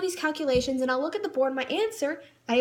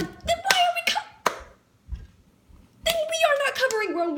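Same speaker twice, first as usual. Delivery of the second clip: much higher, indignant cry; indignant outburst; 13 semitones higher than usual. Retold or imitated. Student objects to the teacher's Rome lesson, higher indignant outburst. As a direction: burst out in protest. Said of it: "And I'm like".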